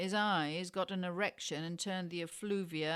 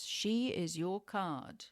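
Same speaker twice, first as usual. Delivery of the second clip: usual speaking voice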